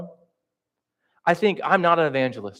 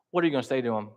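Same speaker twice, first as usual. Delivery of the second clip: ordinary delivery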